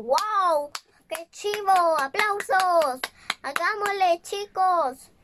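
loud, thrilled cheer; rally the crowd with joy; excited cheering. Acted. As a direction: Dumbo cheering the crowd in his dream, excited. Dumbo cheering in his dream.

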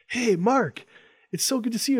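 high, bright-eyed hello to a local hero; mock fan greeting, high and eager; excited greeting. Customers greeting Mark.

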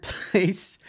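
laughing through the word. Real, not acted. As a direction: chuckle it out. Amused at third place trophy.

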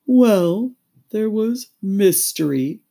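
high, loud, slow mournful voice; melancholy Mock Turtle. A narrator's reading. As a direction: drag it out sorrowfully.

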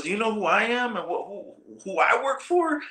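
very high mock indignation; retold high-pitched outrage; indignant. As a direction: imitate an officer pulling rank, voice way up. Acting out marshal pulling rank.

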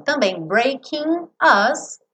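ordinary delivery

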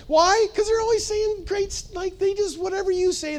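high, sing-song mimic of flatterers; mocking gush. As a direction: pitch way up to poke fun at yes-men. Mocking always-agreeing friends.

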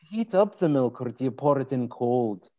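playful put-on Scottish lilt; Scottish accent. Host quizzing guest about milky tea, Scottish accent. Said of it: "key to a good Scottish accent".

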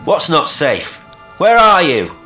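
loud, high urgent questions; calling into darkness. A narrator's reading. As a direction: shout questions out to someone unseen. Calling into the dark, astonished.

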